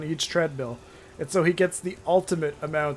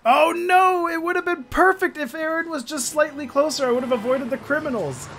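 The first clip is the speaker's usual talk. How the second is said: dismayed cry; 9 semitones higher, 3 dB louder than usual. Real, not acted. Game frustration.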